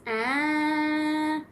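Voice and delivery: high, loud steady held ah; car engine ah. Pretend. Playing car for a toddler, high held ah. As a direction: drive it like a toy car. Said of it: "the car goes".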